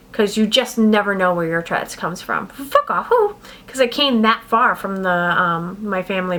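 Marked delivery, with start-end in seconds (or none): 2.5-3.4 abrupt raised tic blurt; blurted tic between thoughts; vocal tic. Real, not acted. Vocal tic interrupting story.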